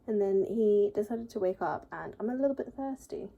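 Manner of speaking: ordinary talk, nothing marked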